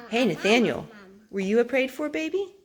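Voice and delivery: plain reading voice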